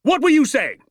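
loud, high parade-ground bark; shouted demand. A narrator's reading. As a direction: shout it as if at a platoon. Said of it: "He was shouting at me".

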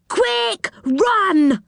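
high urgent cry. Acted. Urging escape.

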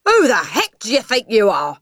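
loud, high outraged father; angry shout. Acted. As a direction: explode at your cheeky child. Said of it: "he shouted".

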